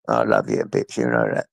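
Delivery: deep affectionate murmur to the dog; low pet voice. Real, not acted. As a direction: rumble it low and fond at the pet. Tender talk to the dog.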